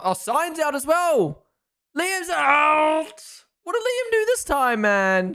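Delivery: high, loud cry of disbelief; real shocked yelling; shocked outburst. Real, not acted. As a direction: exclaim in alarm, pitch leaping up and words stretched. Shocked reaction to drivers knocked out.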